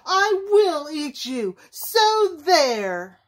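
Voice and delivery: roaring threat. A narrator's reading. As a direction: roar it with a storybook temper. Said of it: "he roared".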